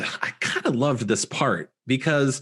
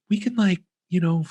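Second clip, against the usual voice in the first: higher-pitched, halting, tentative plea; understated begging; 6 semitones higher than usual. Retold or imitated. Girl trying to reason with her kidnapper, understated begging. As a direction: bargain gently in a raised voice, mildly pleading. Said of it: "kinda begging, but not even in this over the top".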